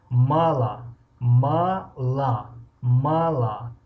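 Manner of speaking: мало said as мала, unstressed о becomes а; teacher showing мало read as мала; reduced o. Shown as a demonstration. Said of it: "мала мехонем".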